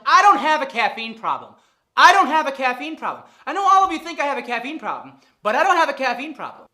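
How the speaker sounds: loud, laughing defensive shout; defensive denial. Retold or imitated. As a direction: insist loudly you're fine. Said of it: "And I'm like".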